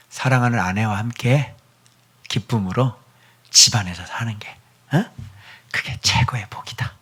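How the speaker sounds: soft, whispered conclusion; aged king whispering his last lesson; hushed wisdom. Acted. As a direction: breathe the words like a secret. Solomon's final wisdom.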